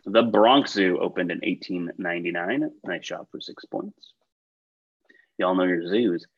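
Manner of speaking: usual speaking voice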